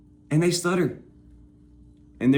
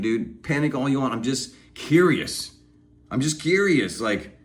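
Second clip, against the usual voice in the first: ordinary talk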